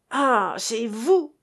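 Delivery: slow shrill yelp of the angry cook; drawn-out yelp. A narrator's reading. Angry woman yelps at the narrator who surprised her. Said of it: "glapit-elle".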